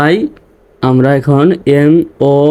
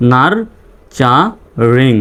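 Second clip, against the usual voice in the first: very slow "nurturing", a gap after each syllable; teacher's slow sounding-out; much slower than usual. Shown as a demonstration. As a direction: break "nurturing" into nar-cha-ring. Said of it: "reading পড়ে আসি".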